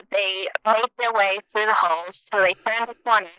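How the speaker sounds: mock news anchor reading. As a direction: read it like a radio bulletin. Reads a made-up news bulletin.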